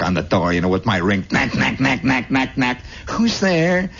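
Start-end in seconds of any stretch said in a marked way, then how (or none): 1.3-2.8 rapid even word-knocks; staccato door-knock imitation; imitated knocking. Imitates ring rapping on door. 3.1-3.9 high singsong lady calling; put-on high neighbor voice. Neighbor answers the door.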